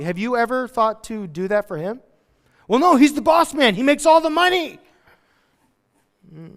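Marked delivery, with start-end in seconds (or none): 2.7-4.8 high, loud mock objection; acted-out outraged employee; indignant protest. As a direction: protest loudly, pitched way up. Acts out indignant objection to treating boss.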